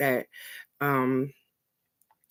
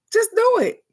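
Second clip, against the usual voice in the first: squeaky high snapped command; high sharp order; 6 dB louder than usual. Retold or imitated. Inner voice ordering her to just obey, high and loud. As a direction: bark it in a shrill-high mock voice.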